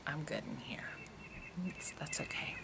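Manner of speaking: put-on baby's voice as a soft whisper; tiny unvoiced whisper, content and unbothered. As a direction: whisper it like the baby talking from inside. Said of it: "he was like".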